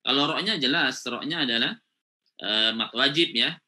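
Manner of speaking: ordinary talk, nothing marked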